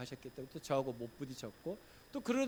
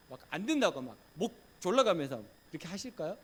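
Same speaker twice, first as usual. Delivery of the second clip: ordinary delivery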